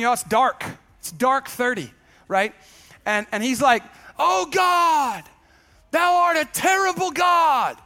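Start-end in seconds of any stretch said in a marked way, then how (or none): 4.2-7.8 loud, high King James holler; hollering prayer. Retold or imitated. Brother hollering his prayer at five in the morning, loud. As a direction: bellow it like a Pentecostal brother at dawn. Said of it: "yelling, hollering at five in the morning".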